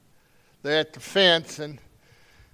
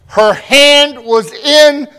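loud, high, fervent sermon delivery; preaching at full force; 6 semitones higher, 12 dB louder than usual. Acted. Pastor driving home the point to his church, full-force preaching. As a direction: preach it out to the congregation.

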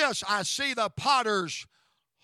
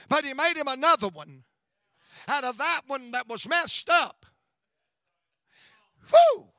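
steady driving repeated lines, louder; preaching; 3 semitones higher, 4 dB louder than usual. Acted. Pastor assuring listeners that broken vessels are remade, fervent preaching. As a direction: drive each repeated line home.

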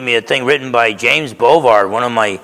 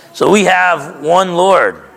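slow emphatic refrain; 6 semitones higher, slower than usual. Shown as a demonstration. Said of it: "repeated refrain in the sermon".

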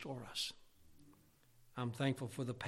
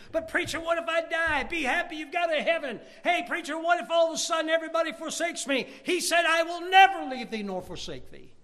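high, loud, driving sermon delivery; full-force preaching; 13 semitones higher, 9 dB louder than usual. Real, not acted. Preacher answering a congregation's doubts, high full-force preaching. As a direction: preach it at a high, sustained shout.

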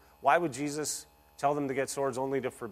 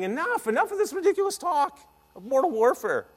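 much higher, louder, fed-up cry of enough; exasperated rebuke; 14 semitones higher, 7 dB louder than usual. Acted. Jesus cuts off the disciples' sword talk, exasperated high cry. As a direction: throw up your hands and cut the nonsense off.

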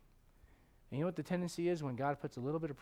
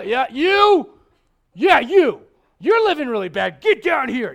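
shouted, much louder, pointing someone out; mock call-out; 9 semitones higher, 20 dB louder, slower than usual. Pastor mocking a preacher who singles people out, shouting. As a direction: yell at a sinner in the crowd. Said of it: "I don't go out in the crowd and go".

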